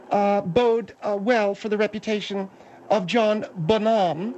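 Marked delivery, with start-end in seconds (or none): 3.1-4.3 John BONHAM drawn out slowly as "buh-NAHM", stressed on the end; mock French pronunciation. Guest correcting the host on the drummer's name, mock French pronunciation. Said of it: "which is the proper French pronunciation".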